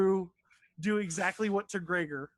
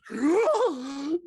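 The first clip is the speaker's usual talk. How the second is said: loud, high gasp for air; big acted drowning gasp; 7 semitones higher, 5 dB louder than usual. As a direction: gasp hard, like surfacing from underwater. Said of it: "gasping up".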